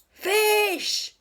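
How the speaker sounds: very high, loud "Fish"; a captain declaring the answer; pirate captain. A narrator's reading. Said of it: "said the pirate captain".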